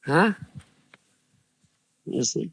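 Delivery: normal talking voice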